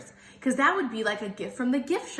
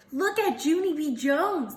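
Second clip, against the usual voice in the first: put-on higher, sing-song taunting kids; mocking kids hollering; 6 semitones higher than usual. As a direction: tease like a schoolyard bully. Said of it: "they hollered".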